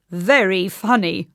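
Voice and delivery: slow, lightly voiced joker. A narrator's reading. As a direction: savour the words a little. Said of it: "he added modestly".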